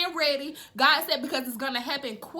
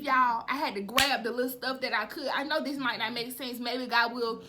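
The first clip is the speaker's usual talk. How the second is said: ordinary talk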